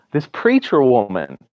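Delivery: usual speaking voice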